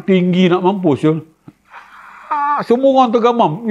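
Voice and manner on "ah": very high, thin wail; rocket-like shriek. Retold or imitated. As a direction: mimic a screeching rocket. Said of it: "macam bunyi roket". (jeritan tinggi macam roket)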